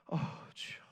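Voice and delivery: a breathy, voiceless groan of prayer; whispered sigh of prayer. Shown as a demonstration. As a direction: let out a whispered sigh to the Lord. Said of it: "탄식 알잖아요".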